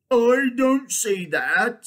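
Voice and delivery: loud, slow, high bear voice; doubtful bear. Acted. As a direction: object slowly as a lumbering bear. Bear figure disagrees in story.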